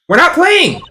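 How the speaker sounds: high-voiced kid protesting; indignant girl. Girl character protesting to detective.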